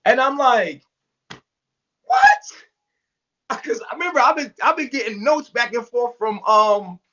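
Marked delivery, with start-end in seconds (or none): 2.0-3.6 high, incredulous "what"; retold stunned outburst, pitched way up; shocked disbelief. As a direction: blurt it out in disbelief. Retelling own shocked reaction.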